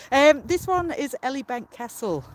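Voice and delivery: ordinary talk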